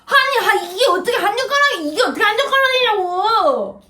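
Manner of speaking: whiny, indignant complaint; tearful protest. Real, not acted. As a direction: argue back while close to crying, stretching the endings. Brother disputes sister's one bite. (징징대며 억울하게 따지기)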